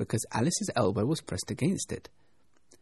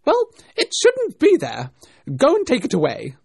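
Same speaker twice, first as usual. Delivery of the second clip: angry rabbit; 13 semitones higher, 12 dB louder than usual. A narrator's reading. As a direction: order it gone in an exasperated shout. Angry rabbit orders the servant.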